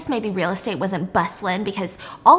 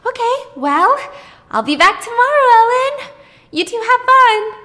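much higher, louder chirpy farewell; sing-song goodbye; 14 semitones higher, 8 dB louder than usual. Retold or imitated. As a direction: leave them with a bright teasing lilt. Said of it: "and she says".

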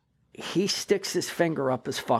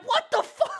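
laughing disbelief. Retold or imitated. Officers stunned, laughing.

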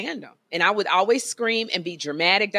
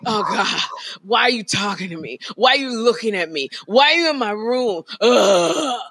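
whiny imitation, building louder to a groan; mock teen annoyance, each question more pained; exasperated teenager; 4 dB louder than usual. Mother imitating her son when she greets him, exasperated whine. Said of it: "he gets all loose in his body".

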